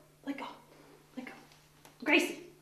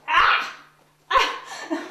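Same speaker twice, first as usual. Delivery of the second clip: sharp loud yelp as claws dig in; startled pained yelp; 5 semitones higher, 8 dB louder than usual. Real, not acted. Owner yelps as the cat claws her, loud. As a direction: cry out at the scratch.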